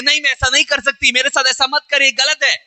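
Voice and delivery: pleading cry. Retold or imitated. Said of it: "चीख रही है".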